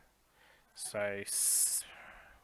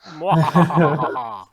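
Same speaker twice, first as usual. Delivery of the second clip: slow, loud villain laugh; evil laugh; 4 semitones higher, 17 dB louder, much slower than usual. Acted. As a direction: gloat with a mock evil laugh. Gloating at goblin count.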